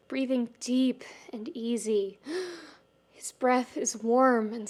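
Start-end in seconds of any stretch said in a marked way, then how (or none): 0.1-2.2 soft whisper. Acted. Shiro whispering.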